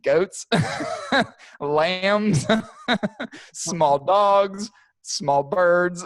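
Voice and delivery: laughing through it. Real, not acted. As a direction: crack up while listing. Laughing while listing vulture prey.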